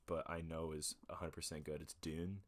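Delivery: ordinary delivery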